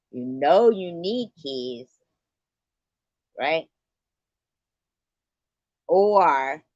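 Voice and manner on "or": loud drawn-out or. Real, not acted.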